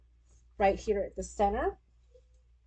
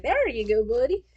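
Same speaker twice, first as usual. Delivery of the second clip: imitated British accent; 3 semitones higher, 6 dB louder than usual. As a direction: mimic a daughter suddenly sounding British. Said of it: "like my daughter starts sounding British".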